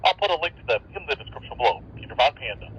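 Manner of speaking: tinny radio transmission from across the field; talking through a handheld radio, wind behind; walkie-talkie audio; over a walkie-talkie. Real, not acted.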